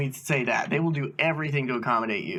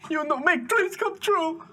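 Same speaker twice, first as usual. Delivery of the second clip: high, slow, wailing imitation of an accusation; mock breaking into tears, pitched way up; tearful outburst; slower than usual. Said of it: "Genuine tears out of his eyes".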